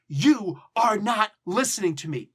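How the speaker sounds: "You are not listening to me" punched word by word, loud and high; loud accusation with "You are not" hammered out; accusatory, every word stressed. Shown as a demonstration. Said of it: "I'm emphasizing every word".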